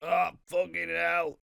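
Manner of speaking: slow, strained groan of a voice; weary chair voice. Pretend. A talking chair complaining, slow strained groan. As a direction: groan like a chair under weight. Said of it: "just like".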